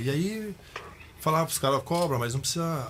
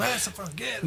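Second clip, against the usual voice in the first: imitated heckler's yell; 8 semitones higher, 3 dB quieter than usual. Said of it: "o cara gritando de novo".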